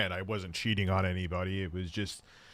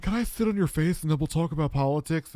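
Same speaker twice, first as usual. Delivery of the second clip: higher put-on voice; strange woman's voice; 6 semitones higher than usual. Man imitating a past hookup making an offer, strange voice. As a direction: mimic an odd woman's request. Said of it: "she had a very strange voice".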